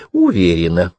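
«Уверена» as a sly, falling answer; sly smile. A narrator's reading. Miyuki winks at her friend and answers, sly smile. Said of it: "с хитрой улыбкой сказала".

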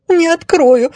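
high, sobbed refusal; tearful, high-pitched lady; sobbing. Acted. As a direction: sob it out in a high damsel voice. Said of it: "всхлипнула красавица".

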